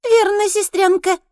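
shrill gloating creature voice; squeaky voice. A narrator's reading. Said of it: "отвечает ей чернокрылая".

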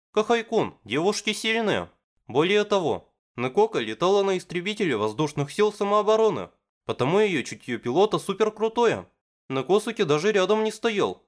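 high, eager fan gushing; excited girl voice. Acted. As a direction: praise the heroine breathlessly. Misaki gushing, raised voice.

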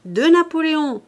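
high, loud tempting call; appel crié. A narrator's reading. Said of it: "l'homme qui lui criait". (cri haut et fort pour l'appâter)

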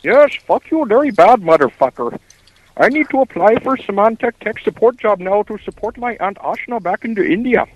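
exaggerated Indian accent, angry call-center worker telling off a prank caller; bad Indian accent. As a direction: rant in a hammed-up sing-song Indian accent. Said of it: "That's the worst accent".

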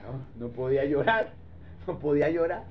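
low, soft, choked-back voice; a man fighting not to cry, quiet; holding back tears. Acted. Preacher as Joseph to his brothers, softly holding back tears.

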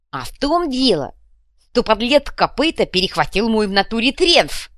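high, loud lisping complaint; aggrieved fuhrer. A narrator's reading. Fuhrer accuses Kopyta of theft, aggrieved. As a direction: lament with blustering outrage.

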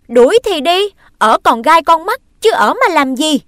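loud and high; expelled boy retorts as he leaves, loud; bitter parting shot. Acted. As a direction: storm off saying it.

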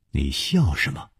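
low, soft puzzled query; puzzled question. A narrator's reading. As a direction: ask quietly and low. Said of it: "又忍不住说".

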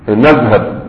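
ordinary delivery

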